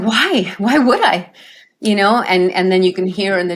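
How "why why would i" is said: protesting cry. Retold or imitated. Father protesting being sent back.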